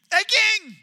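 loud, high, gleeful greeting; all thrilled. Retold or imitated. Servant bursts in gleefully greeting the king, loud and high. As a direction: greet the king like it's payday. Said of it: "Like, all thrilled".

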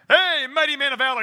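loud, bright heraldic call; booming angel. Acted. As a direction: announce him like a herald angel. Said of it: "This angel shows up and goes".

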